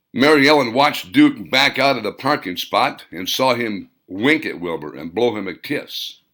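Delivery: ordinary delivery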